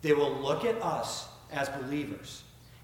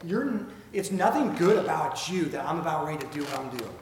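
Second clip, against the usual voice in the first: ordinary talk, nothing marked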